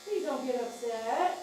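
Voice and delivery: slow, drawn-out coaxing chant; sing-song plea. Real, not acted. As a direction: soothe the dog with a lilting, repeated plea. Soothing the dog with chanted plea.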